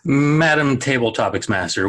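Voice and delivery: ordinary talk, nothing marked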